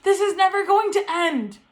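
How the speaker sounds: high, half-laughing despair; a frantic, high inner wail that it will never end; inner panic. Retold or imitated. To herself on the phone, half-laughing panic. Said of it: "in my head I was like".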